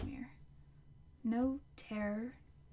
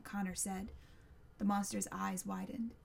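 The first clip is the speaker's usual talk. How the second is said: usual speaking voice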